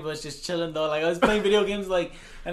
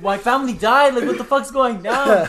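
loud, high, slow mock panic; mock panicked yell; 6 semitones higher, 9 dB louder, slower than usual. Retelling his shocked reaction to the text, mock yell.

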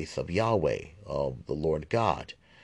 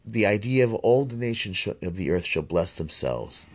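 ordinary talk

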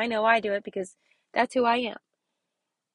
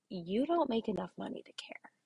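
whispered punchline; 3 semitones lower, 8 dB quieter than usual. Retold or imitated. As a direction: whisper the advice, unvoiced and soft. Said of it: "My response to that is".

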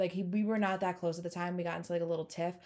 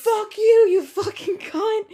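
shrieking curse, very high and loud; drunken screaming; 12 dB louder, slower than usual. Retold or imitated. Drunk caller screaming at her boss, shrieking. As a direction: scream it down the phone, drunk and furious.

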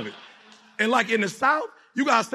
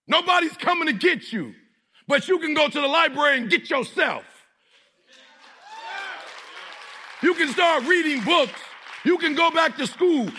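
motivational preaching; 5 semitones higher than usual. Acted. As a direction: preach it hard in punchy, repeated phrases. Motivational preaching at full force.

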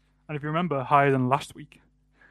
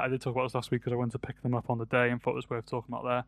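normal talking voice

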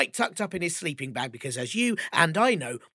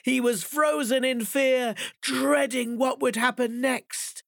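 higher, slower fearful narration; frightened; 8 semitones higher, slower than usual. A narrator's reading. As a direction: tell it frozen with dread.

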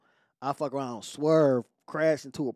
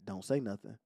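softer, low hushed warning; 9 semitones lower, 9 dB quieter than usual. Retold or imitated. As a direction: tell him quietly to keep his mouth shut. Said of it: "she say".

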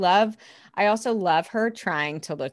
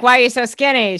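louder teasing impression; mock loud impression; 4 semitones higher, 9 dB louder, faster than usual. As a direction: bark it out as a brash boss. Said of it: "she's like".

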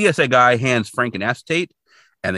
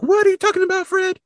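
very high, squeaky mock exasperation; incredulous reply; 4 dB louder, faster than usual. Friend questioning Fred's silly plan, mock incredulous. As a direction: question him in a comically high-pitched voice.

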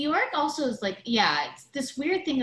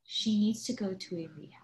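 conspiratorial whisper, breathy and hushed; 6 dB quieter than usual. Real, not acted. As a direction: lean in and whisper it like gossip. Aside about Dorinda.

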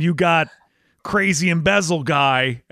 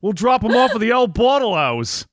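higher, hammed-up Australian imitation; bad Australian accent; 6 semitones higher than usual. Host plays Australians heading to the liquor store, hammed-up Australian accent. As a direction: ham up a broad Australian accent, pitched up. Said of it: "doing my bad Australian accent".

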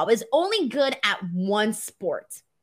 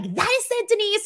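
high-pitched, fed-up mom snapping her daughter's name; imitating an exasperated mom who has had enough; angry mom; 12 semitones higher than usual. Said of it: "She would get really angry".

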